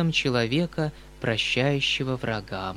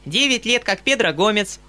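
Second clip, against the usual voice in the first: put-on small, even, clear child voice, much higher, no pauses; child reciting verse; 8 semitones higher, 5 dB louder than usual. A narrator's reading. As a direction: rattle it off like a child reciting memorized poem. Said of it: "маленьким, ровным и ясным голосом, без запятых и без точек". (детское заученное чтение стихов)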